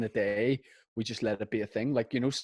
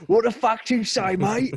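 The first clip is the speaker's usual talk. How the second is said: mock bar lad; 11 semitones higher, 5 dB louder than usual.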